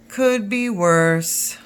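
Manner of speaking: put-on slow, deliberate old grandpa; lightly voiced grandfather, unhurried; deadpan grandpa catchphrase; slow grandpa drawl.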